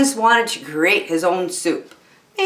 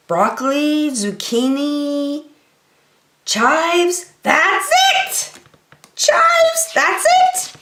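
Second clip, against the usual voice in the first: high, rising squeal of a breakthrough; the little chef cries out as the idea lands; cartoon duck bursting with excitement; excited discovery; 8 semitones higher, slower than usual. Max finds the missing herb.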